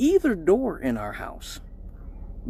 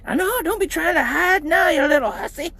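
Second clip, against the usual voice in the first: high scolding mom; high hammed-up mom scolding; angry mom voice; 10 semitones higher than usual. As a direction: scold like his mom kicking the door in.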